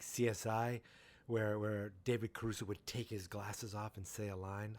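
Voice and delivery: normal talking voice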